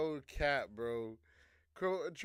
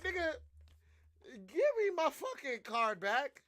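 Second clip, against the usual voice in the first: mock Trump demand; 8 semitones higher than usual. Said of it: "Trump said".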